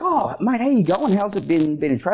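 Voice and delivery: high, bright mock greeting of the trainer; imitating the trainer's friendly hello; cheery old trainer.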